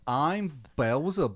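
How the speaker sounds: put-on high, slow child voice; child's voice. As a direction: introduce yourself like a small child. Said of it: "it has the voice of, like, a child".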